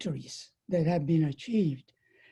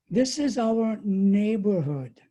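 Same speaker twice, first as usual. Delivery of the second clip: "is" leaned on, slightly louder; emphasis on is; 2 semitones higher, 3 dB louder than usual. Shown as a demonstration. Quoting a minister's statement, stressing "is". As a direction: stress the word "is" in our neighborhood. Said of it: "That's my emphasis".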